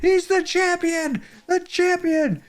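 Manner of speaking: mock sports announcer. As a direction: hype the kid's win like a commentator. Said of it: "and I said".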